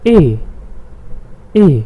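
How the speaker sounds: A said as German "eh"; A as the German name of E, "eh"; German letter E. Shown as a demonstration. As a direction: say A as a long pure "eh". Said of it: "we call it".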